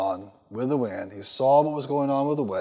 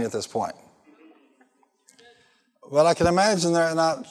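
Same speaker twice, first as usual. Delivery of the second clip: preaching at full force; 3 semitones higher than usual. Acted. Pastor rousing the congregation about one word, full force preaching. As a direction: drive each line home to the crowd.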